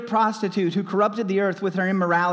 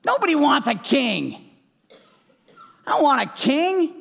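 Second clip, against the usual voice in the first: emphatic preaching; 7 semitones higher than usual. Acted.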